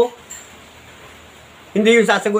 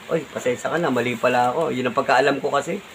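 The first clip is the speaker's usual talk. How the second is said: a gentle, easy apology, said much softer; soft sample apology; 7 semitones lower, 6 dB quieter than usual. Shown as a demonstration. Vlogger shows his critics how to say sorry: soft, humble apology. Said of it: "madali lang naman banggitin ng sorry".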